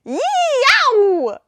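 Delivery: long high cartoon yelp. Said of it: "it says".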